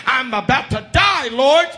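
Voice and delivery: high, loud cry to heaven; retold desperate appeal, rising at the end; desperate plea. Woman pleading with God as dogs close in, desperate.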